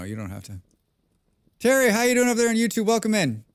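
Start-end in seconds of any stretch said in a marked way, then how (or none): 1.6-3.4 fast, high welcome to a viewer; cheery greeting. Real, not acted. Streamer welcoming Terry from chat, cheery and quick. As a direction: greet him like a regular.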